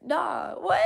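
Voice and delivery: ordinary talk, nothing marked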